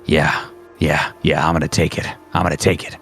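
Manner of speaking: low, hungry repetition; jonesing for the shot, pitched low; lustful eagerness. Acted. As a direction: murmur it low, itching to fire. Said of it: "you almost hear the sound of, like, lust".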